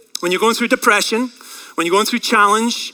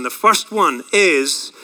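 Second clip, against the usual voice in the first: ordinary talk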